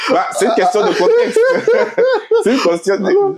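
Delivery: high, laughing insistence. Real, not acted. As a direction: protest through laughter, pitch jumping up. Amused rebuttal in friendly debate.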